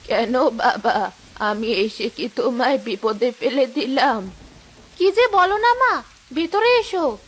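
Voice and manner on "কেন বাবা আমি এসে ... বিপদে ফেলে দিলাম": put-on low, slow old woman calling; old mother. Old mother calls her daughter Rehana from the yard, low and slow. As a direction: call out like an elderly mother.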